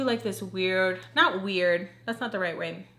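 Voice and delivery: normal talking voice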